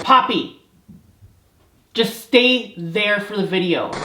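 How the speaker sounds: high, loud yell at the cat; sharp real shout at a licking cat; scolding shout. Real, not acted. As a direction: snap at the pet to stop.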